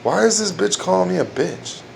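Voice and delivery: mock-baffled inner thought; mock puzzled. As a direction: act baffled at the insult. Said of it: "you think to yourself".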